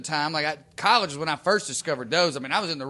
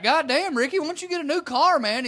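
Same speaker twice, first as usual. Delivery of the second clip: mock teasing; 10 semitones higher than usual. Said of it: "we were like".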